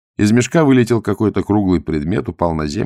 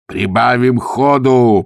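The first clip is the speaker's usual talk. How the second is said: loud, higher, drawn-out urging; growled shout; 5 semitones higher, 6 dB louder, much slower than usual. A narrator's reading. Gonzalin urges the fugitives to speed up, growling. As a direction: roar it slowly to spur the riders on. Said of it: "прорычал Гонзалин".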